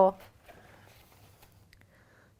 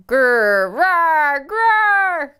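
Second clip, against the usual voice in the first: louder mock growl, climbing higher; bear growls; 6 semitones higher, 5 dB louder, much slower than usual. Mom growls as the angry bear, louder and higher. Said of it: "Let's shout about it".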